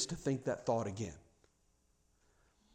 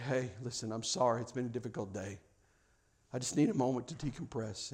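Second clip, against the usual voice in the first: soft, low, calm sorry to the family; gentle apology; 4 semitones lower, 9 dB quieter than usual. Retold or imitated. Parent apologizing to the family, soft and calm. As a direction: ease the tension, speak softly.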